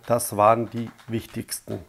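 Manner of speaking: usual speaking voice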